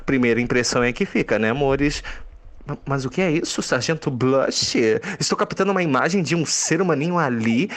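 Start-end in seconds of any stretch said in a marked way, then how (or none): none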